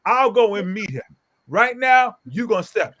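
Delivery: high, punched retold threat; confrontational challenge. Father offering to meet the other father, confrontational challenge. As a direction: push each phrase out forcefully.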